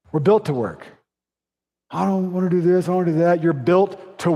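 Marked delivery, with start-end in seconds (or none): 1.9-3.4 whiny complainer. Retold or imitated.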